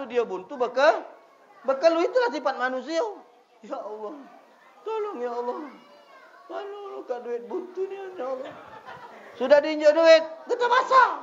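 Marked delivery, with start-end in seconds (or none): 3.6-8.5 high, thin begging voice, hammed up; mock desperate prayer. Mocking a broke man's prayer. 9.4-11.1 much higher and louder, breaking into laughter; laughing punchline. Pretend. Punchline after mock prayer.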